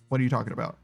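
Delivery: ordinary delivery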